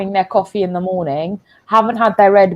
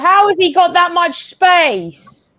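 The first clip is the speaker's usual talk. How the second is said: high, drawn-out disbelief; incredulous complaint; 7 semitones higher, slower than usual. Real, not acted. Fan watching poor defending, incredulous complaint. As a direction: complain slowly in a raised, baffled pitch.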